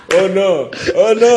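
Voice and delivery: high laughing protest. Real, not acted. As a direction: squeal it through laughter. Laughing at the letter.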